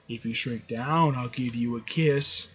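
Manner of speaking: low, soft and slow, as a teasing offer; flirty coaxing. Acted. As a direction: drop to a hushed, seductive murmur. Janet coaxing Hank with a kiss.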